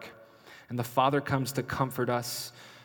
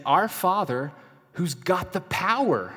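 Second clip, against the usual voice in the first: higher and louder, leaning on power; powerful emphasis; 6 semitones higher, 5 dB louder than usual. Retold or imitated. Preacher voicing Jesus's prayer with bold, loud emphasis. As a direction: proclaim the Father's might, drawn out. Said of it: "He's saying".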